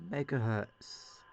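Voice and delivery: ordinary talk, nothing marked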